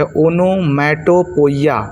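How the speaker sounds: ओनोमैटोपोइया drawn out slowly, ending "po-i-ya"; children's mispronunciation. Shown as a demonstration. Teacher showing how children misread the term, ओनोमैटोपोइया ending in "poiya". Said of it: "इसको बच्चे pronounce क्या करते हैं?".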